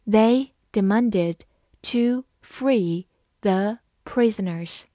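slow, word by word; measured teacher's model reading. Shown as a demonstration. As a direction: read slowly with pauses between words. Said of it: "慢读一遍".

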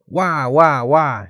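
put-on storybook baby crying noise; imitated baby wail. A narrator's reading. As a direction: read out a baby's wail, drawn out. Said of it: "she cried".

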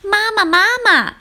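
high-pitched little tadpole calling Mama. A narrator's reading. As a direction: call eagerly after mother. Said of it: "追上去喊妈妈".

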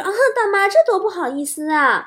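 high-pitched, bashful protest; coy young woman. Retold or imitated. Woman offered a dog by its owner, coy protest. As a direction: put on a politely flattered woman's voice. Woman coyly declines gift.

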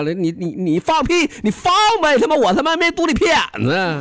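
stammering start, then a high, exasperated blow-up; mock-furious cabbie snapping back at his passenger; hot-tempered outburst. Taxi driver loses his temper at the passenger, high mock rage. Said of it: "他妈一暴脾气呀".

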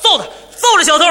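高声喊着要揍小偷; 像愤怒的路人一样喊打; 群众喊打. Retold or imitated. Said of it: "直嚷嚷". (围观路人高声喊打小偷)

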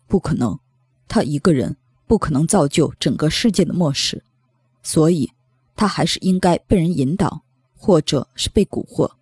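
low, even voice of someone thinking aloud; pensive man's voice. Acted. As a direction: reason it out in a low voice. Narrator voices Xiu Yong reasoning.

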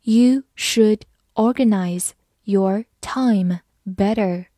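slow, careful read-aloud, every word distinct. Shown as a demonstration. Teacher reading a sentence slowly to students. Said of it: "好，慢慢来读。".

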